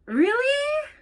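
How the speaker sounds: high incredulous really; mock-shocked question; sarcastic disbelief. Real, not acted. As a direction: drip with disbelief. Sarcastic reaction.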